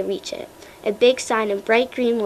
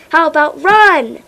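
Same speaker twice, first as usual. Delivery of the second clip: slow, loud, higher call to run; urgent shout; 6 semitones higher, 10 dB louder than usual. A narrator's reading. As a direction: yell the obvious answer. Sarcastic urging to flee.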